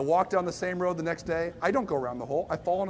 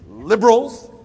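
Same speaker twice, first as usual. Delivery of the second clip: liberal as a swear word; 4 semitones higher, 12 dB louder, slower than usual. Retold or imitated. Stage two calling someone liberal, mock disgust. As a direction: spit liberals louder and higher like a curse. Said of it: "the word liberal as, like, a swear word".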